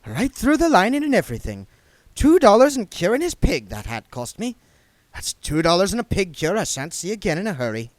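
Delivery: loud, high, flustered babble; hurried, flustered. A narrator's reading. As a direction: rush to break the tension. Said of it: "said Nanny Ogg hurriedly".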